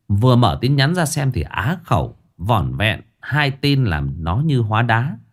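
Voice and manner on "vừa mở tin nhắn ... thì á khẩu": usual speaking voice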